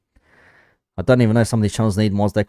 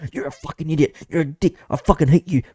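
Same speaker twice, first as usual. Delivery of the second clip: mock angry commenter; 6 semitones higher than usual.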